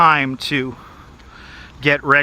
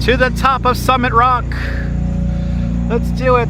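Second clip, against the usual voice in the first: excited; 8 semitones higher, 3 dB louder than usual. Real, not acted. Cheering on the climb up.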